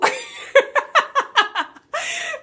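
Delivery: high, squealing laugh; high laughter. Real, not acted. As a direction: let a giddy laugh fly. Laughing fit.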